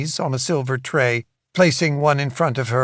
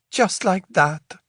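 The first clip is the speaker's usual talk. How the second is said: Isolde's voice; 5 semitones higher, slower than usual. A narrator's reading. Twisting the pillow.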